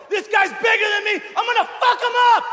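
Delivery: very high, fast screaming rant; crazed yell. Retold or imitated. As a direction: scream it like a madman.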